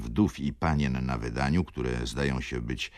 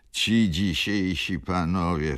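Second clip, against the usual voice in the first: wheezy gentleman; 3 semitones higher, 4 dB louder than usual. A narrator's reading. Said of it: "wtrącił jegomość dychawiczny".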